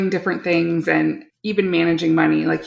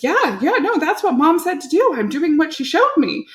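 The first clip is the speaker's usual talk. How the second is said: put-on much higher, protesting kid's voice; retold insistent self-defence, pitched up; imitating defensive son; 9 semitones higher than usual. Middle son defends his egg method to dad, imitated protest.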